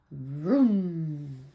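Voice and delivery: loud imitated snowmobile roar; drawn-out storybook vroom; engine vroom. A narrator's reading. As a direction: rev it like an engine, long and loud. Snowmobile races down the trail.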